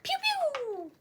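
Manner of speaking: very high, soft pew sound; squeaky falling zaps; imitated laser noise. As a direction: make playful toy-laser noises. Playful imitated shooting noise.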